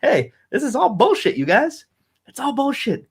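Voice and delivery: mock the podcast guest waving it all off. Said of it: "were like".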